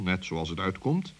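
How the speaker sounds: ordinary delivery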